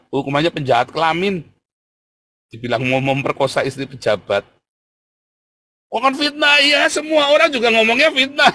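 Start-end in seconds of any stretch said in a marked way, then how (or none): 5.9-8.5 high, loud mock protest; seruan dalih menirukan. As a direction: exclaim it like a much-heard excuse, pitched way up. Menirukan dalih para napi. (seruan protes pura-pura, nyaring dan tinggi)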